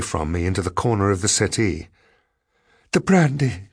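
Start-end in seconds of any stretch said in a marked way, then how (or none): 2.9-3.7 higher-pitched gasping plea; gasped. A narrator's reading. Cowering woman begs the man for brandy, gasped. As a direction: plead for it with a mild gasp. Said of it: "she gasped".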